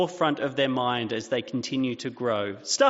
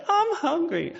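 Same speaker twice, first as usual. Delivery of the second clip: put-on much higher, whining child voice; whiny child. A father imitating his children's whine. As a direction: pitch it up like a whining kid. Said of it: "they'll say".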